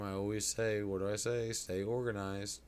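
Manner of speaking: ordinary delivery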